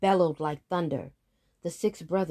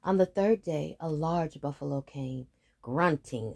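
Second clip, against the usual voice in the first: usual speaking voice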